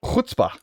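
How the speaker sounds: CHUTZPAH opened with a raspy throat-scraped "kh"; hard throaty first sound on Chutzpah; guttural throat sound. Shown as a demonstration. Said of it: "Do they have the".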